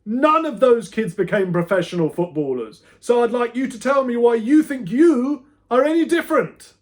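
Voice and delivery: high, loud furious demand; teacher demanding an answer from Jamie; barking teacher. A narrator's reading. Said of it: "barked Pratley".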